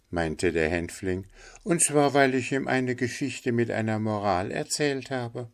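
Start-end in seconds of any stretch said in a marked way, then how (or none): 1.6-5.5 high bird voice; concerned linnet. Acted. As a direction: explain it in a high voice. Figure of the linnet. (Hänfling mit hoher Vogelstimme)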